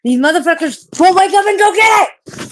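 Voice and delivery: high, loud outburst of exasperation; indignant yell. Real, not acted. Streamer spooked by a mouse noise, indignant yell. Yelling about sleeping pets, frightened of mice.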